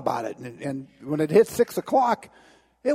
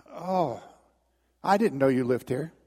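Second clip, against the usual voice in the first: deflated, sheepish Satan; 5 semitones lower, 3 dB quieter than usual. Acted. Satan backs down before Jesus.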